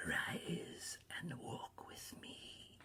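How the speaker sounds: slow, whispered ghostly summons; hushed spirit. A narrator's reading. Spirit bidding Scrooge rise and walk, slow whisper. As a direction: draw it out in a soft whisper.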